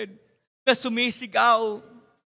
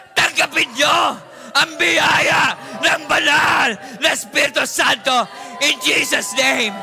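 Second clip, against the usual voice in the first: fiery preaching; 3 semitones higher, 6 dB louder than usual. Acted. Pastor imparting the Holy Ghost to the congregation, shouted. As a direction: proclaim it over the crowd with full force. Altar call, preaching at full force.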